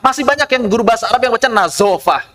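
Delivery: ordinary talk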